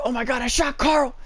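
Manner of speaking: high-pitched melodramatic cry; mock horror. Imitates movie character's horrified cry.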